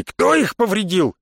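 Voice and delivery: high, slow, angry demand; roaring. A narrator's reading. Бонги demanding who damaged his airships, roaring. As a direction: demand an answer in outrage. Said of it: "взревел Бонги".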